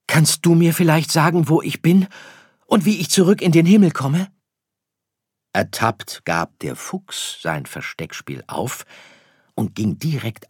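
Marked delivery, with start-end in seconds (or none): 0.1-4.3 high, loud eager question; little star calling out for directions; calling to a stranger. Acted. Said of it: "rief Sirius dem Fremden zu". (laut und hoch nachfragen)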